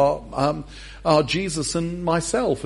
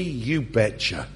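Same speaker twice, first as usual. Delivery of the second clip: usual speaking voice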